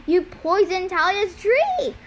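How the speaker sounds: angry accusation. A narrator's reading. Hero confronting Luke over the poisoned tree, angry accusation. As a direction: accuse him, pitch lifted.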